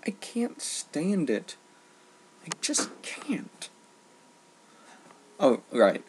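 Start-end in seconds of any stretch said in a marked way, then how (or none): 0.0-3.7 slow, soft exasperated breath, ending in a whisper. Real, not acted. Vlogger muttering his disgust at music. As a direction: drag it out, fading to a whisper.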